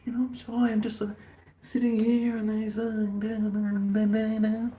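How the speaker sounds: high bashful mock voice; shy neighbour. Neighbour on the veranda playing shy, high and sheepish. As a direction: pretend to be a shy neighbour humming da da, high-pitched. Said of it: "It's like".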